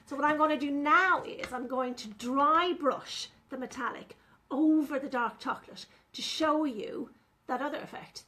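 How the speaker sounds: ordinary talk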